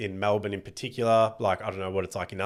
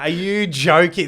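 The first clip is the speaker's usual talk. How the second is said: incredulous outburst; 8 semitones higher, 9 dB louder than usual. Retold or imitated. Disbelief at heckler's reason.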